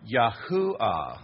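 Yahuwah slow and loud in three beats; three-syllable pronunciation. Shown as a demonstration. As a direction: demonstrate Yahuwah as yah-HU-wah. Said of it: "is three syllables".

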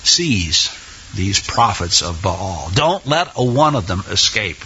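very low, measured order; deep, deliberate voice of authority; grave command. Acted. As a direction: drop low and give the order. Acting Elijah's grim order to seize.

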